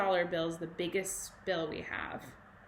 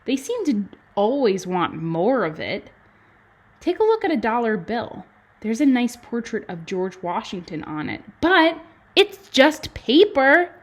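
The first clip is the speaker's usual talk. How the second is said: louder, lively storybook read with bouncing tune; reading aloud to children; 10 dB louder than usual. Acted. Teacher reading a money book to kindergarten, lively and loud. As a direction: read it out big and bright for little kids.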